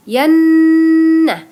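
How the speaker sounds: "yannah" loud and high with a held nasal nn; gunnah demo. Shown as a demonstration. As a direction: hold the "nn" of "yannah" loudly. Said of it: "Kemudian yang kedua".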